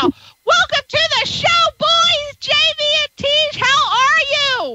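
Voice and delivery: high, drawn-out show greeting; excited welcome. Real, not acted. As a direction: roll out a big high-pitched welcome for the guests. Host welcomes guests onto show.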